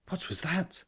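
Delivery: high, hushed, startled query; quick question. A narrator's reading. Woman hearing a sound outside the room, quick hushed question. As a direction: ask it softly, pitched right up. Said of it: "she asked quickly".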